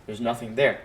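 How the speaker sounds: ordinary talk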